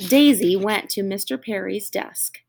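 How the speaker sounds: ordinary delivery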